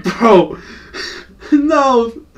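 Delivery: a laugh breaks up a high no; amused, incredulous laugh; laughing disbelief. Retold or imitated. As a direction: crack up mid-word, then squeak out no. Friends laugh at Steven.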